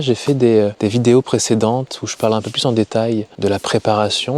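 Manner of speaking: normal talking voice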